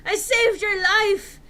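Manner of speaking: high, loud, slow furious yell; angry yell. Acted. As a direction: roar it at her in outrage. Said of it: "he yelled angrily".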